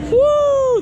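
high thrilled whoop; excited whoop. Real, not acted. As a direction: cheer it out. Cheering after the ride.

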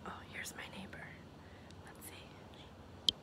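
hushed whisper so the neighbor won't hear. Real, not acted. Vlogger near her neighbor's house, whispering to viewers. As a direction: drop to a secret whisper.

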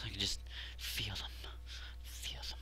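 very soft, unvoiced murmur to yourself; hushed whisper. Real, not acted. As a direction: whisper it under your breath. Psyching himself up quietly.

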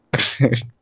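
short, real chuckle, fairly loud; short laugh. Real, not acted. Player amused while gaming, short laugh. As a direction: let out a brief loud laugh. Amused during game play.

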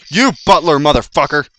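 loud, high shout at an enemy; angry insult. Real, not acted. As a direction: yell abuse at the game. Cursing an enemy in game.

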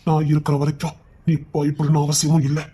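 calm her gently, she's safe with you; father softly comforting his scared daughter; tender reassurance. Acted. Father soothing frightened daughter.

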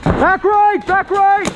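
callout shout. Real, not acted. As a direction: yell the position to teammates. Calling out enemy position.

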